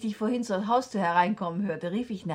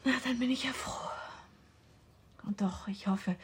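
whispered; 4 semitones higher, 4 dB quieter than usual. Acted. Hushed talk with fiancé.

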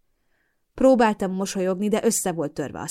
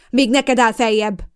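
loud, slow, indignant shriek; 4 semitones higher, 9 dB louder than usual. Acted. Mother shrieks back at her daughter, loud and slow. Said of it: "rikkantotta oda".

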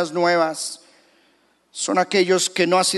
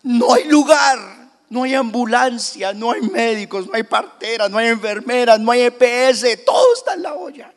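José's frantic report; 6 semitones higher, 4 dB louder than usual. Retold or imitated. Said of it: "le decía seguramente a María".

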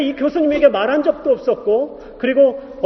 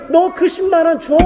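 God's command; 6 semitones higher, 3 dB louder than usual. Retold or imitated. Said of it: "그러는 거예요".

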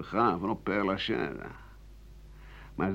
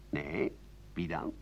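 lower, softer curious reply; a neighbour hushed and intrigued; 8 semitones lower, 10 dB quieter, slower than usual. Retold or imitated. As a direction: ask quietly, eager for the gossip. Second gossiping neighbour answers.

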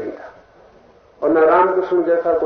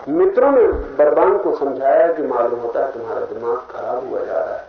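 ordinary talk, nothing marked

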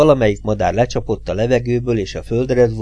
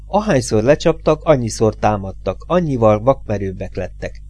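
ordinary delivery

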